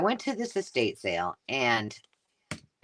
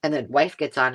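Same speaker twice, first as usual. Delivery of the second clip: ordinary talk, nothing marked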